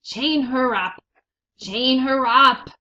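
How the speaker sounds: loud, furious command; angry rat shouting; shouted order. A narrator's reading. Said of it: "shouted Roscuro".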